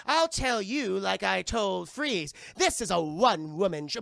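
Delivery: hammed-up comic-book villain speech; villain's declaration. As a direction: proclaim it grandly, stretching words.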